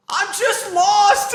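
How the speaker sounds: high, loud, slow cry of I'm just lost; pleading yell. Retold or imitated. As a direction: shout back in a panic. Kid answering the yell.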